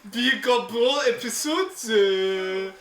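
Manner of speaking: exaggerated French accent; hammed-up French accent. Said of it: "In my French accent".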